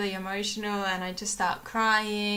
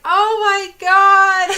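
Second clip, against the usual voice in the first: high, much louder, slow anguished wail; distressed retold cry; 10 semitones higher, 17 dB louder, slower than usual. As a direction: relive the panic as the carrier swings. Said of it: "and I'm like".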